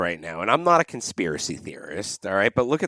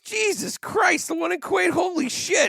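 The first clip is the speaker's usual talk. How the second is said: outraged disbelief. Real, not acted. Outraged at the price.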